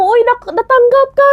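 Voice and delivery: lightly mimicking an excited caller; excited news. Caller announces she got the role.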